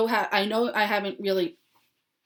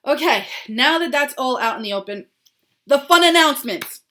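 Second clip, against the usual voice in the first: excited; 6 semitones higher, 9 dB louder than usual. Real, not acted. Switching to fun announcements.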